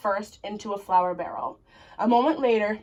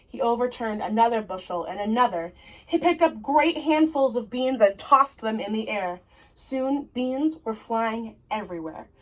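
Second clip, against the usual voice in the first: ordinary delivery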